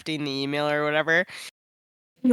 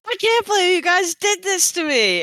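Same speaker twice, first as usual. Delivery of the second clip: mock accusation; 4 dB louder than usual. Confronting friends at breakfast.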